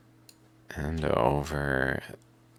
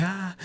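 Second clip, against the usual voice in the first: startled animal squawk, pitched way up; high animal squawk. Retold or imitated. Voicing a spooked animal, a high squawk. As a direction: squeal like a spooked critter.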